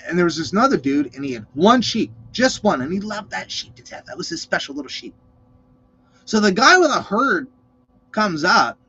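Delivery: normal talking voice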